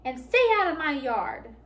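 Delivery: a touch of an angry cactus; yelled back. A narrator's reading. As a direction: shoo them off the yard. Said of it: "Hank yelled back".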